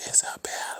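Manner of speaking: a breathless, voiceless whisper of the name; eerie whisper. A narrator's reading. The basement voice calling Isabel down, eerie whisper. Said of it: "and it whispers".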